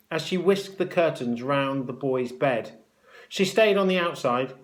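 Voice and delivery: plain reading voice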